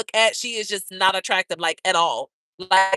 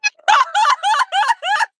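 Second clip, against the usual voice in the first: high, loud cackle; shrieking laugh; 7 dB louder than usual. Real, not acted. Cracking up at her own rhino insult, shrieking laugh. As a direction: burst out cackling.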